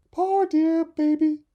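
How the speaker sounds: very high, slow and tender; pitying mother dove. Acted. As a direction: coo over the poor baby. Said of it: "she cried".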